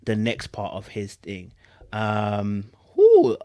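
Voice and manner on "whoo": high, loud whoop of disbelief; loud whoo. Real, not acted. Reacting to the statement with a loud whoo. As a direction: let out a sharp whoo. Exclaiming before reading more.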